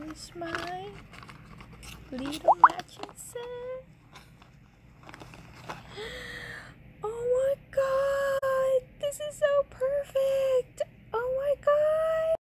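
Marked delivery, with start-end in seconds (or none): 5.8-12.3 emotional gasp. Real, not acted. Overcome by matching set.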